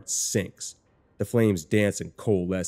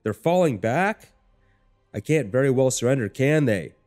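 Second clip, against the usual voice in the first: raised, incredulous battlefield shout; high, loud questioning shout; shout over chaos; 6 semitones higher, 5 dB louder than usual. A narrator's reading. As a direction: call the question out over the noise. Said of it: "it's a shout over the chaos around us".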